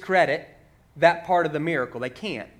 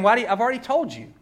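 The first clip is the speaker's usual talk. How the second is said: higher, louder sarcastic comeback; frustrated sarcasm; 6 semitones higher, 7 dB louder than usual. Retold or imitated. Healed man answers the experts questioning him, with frustrated sarcasm. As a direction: let exasperated sarcasm seep into the retort. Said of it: "hear the sarcasm kinda seeping".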